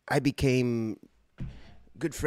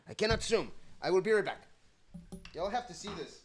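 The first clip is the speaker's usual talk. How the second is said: excited; 10 semitones higher, 4 dB quieter, much faster than usual. Real, not acted. Excited about the gift.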